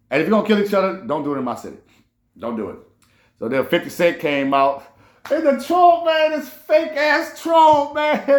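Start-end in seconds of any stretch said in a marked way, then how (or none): 5.3-8.2 much higher, slow, stretched mocking cry; mocking squeal. Real, not acted. Ridiculing [name] as a fake troll, high mocking squeal. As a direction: squeal it out in disbelieving mockery.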